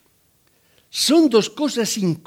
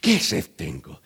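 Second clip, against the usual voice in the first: complaining woman; 11 semitones lower, 3 dB quieter than usual. Retold or imitated. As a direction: groan it like a whiny old woman. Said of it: "aquella mujer que decía".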